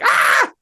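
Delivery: short high-pitched playful screech; imitated toddler scream. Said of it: "woke me up by screaming".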